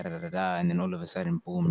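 ordinary talk

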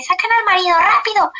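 grito agudo y urgente, imitado; grito del doctor. Retold or imitated. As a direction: repite la orden a gritos, con prisa. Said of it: "y así". (el médico ordena sacar al marido, gritando)

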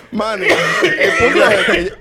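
high, laughter-choked words; slow, helpless laughing speech; real laughing. Real, not acted. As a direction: try to go on while cracking up. Storyteller cracks up mid-story.

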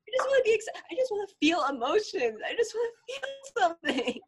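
high-pitched mock-desperate protest; hammed-up pleading. Kid protesting to parents over being hushed, mock pleading.

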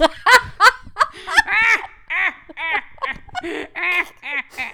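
big mock knee-slap guffaw; hammed-up laugh. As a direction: laugh like a corny dad at his own joke.

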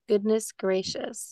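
slow drawn-out Minnesota goodness gracious; Minnesota accent. Shown as a demonstration. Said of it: "Minnesota language".

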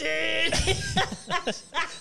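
high breathless laughter. Real, not acted. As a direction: crack up high.

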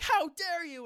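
high, loud, mock-indignant squeal; mock outrage. Mimicking friend's indignant squeal over being blocked. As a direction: squeal it like a wounded diva. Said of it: "She was like".

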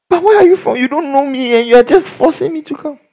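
a lightly voiced protesting young man; indignant guy.

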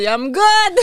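high, loud "all good" call; retold reassurance. Quotes own thumbs-up after crash. (rassurer en criant, voix aiguë)

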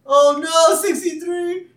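high, loud hammed-up panic; panicked cry. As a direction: wail it out in mock horror. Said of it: "full range of emotions right there".

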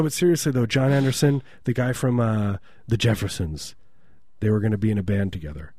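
ordinary delivery

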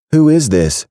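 a falsely sweet baker hurrying off; sweet woman's voice. Acted. Baker to Braxton after the muffin, sugary woman voice. Kat answering a text.

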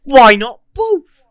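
high, slow, drawn-out catchphrase voice; playful catchphrase. Pretend. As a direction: stretch it out loud and high, jokingly. Playful catchphrase on getting both flavours.

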